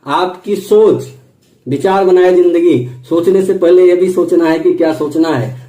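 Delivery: ordinary talk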